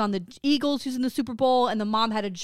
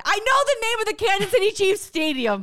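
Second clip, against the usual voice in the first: excited; 9 semitones higher, 4 dB louder than usual. Real, not acted. Proud of knowing stadium name.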